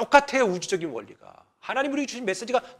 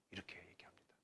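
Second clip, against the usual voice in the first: whisper; 29 dB quieter than usual. Real, not acted. Aside after reading scripture.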